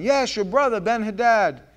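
high, loud eager reply; eager servants. Acted. Servants jump on Ahab's word, high eager reply. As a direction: seize on his word quickly.

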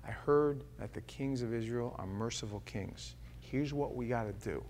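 hushed, low conspiratorial plan; scheming whisper. Acted. Ben-Hadad's servant lays out the plan, hushed scheming voice. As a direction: plot quietly with your men. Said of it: "He goes".

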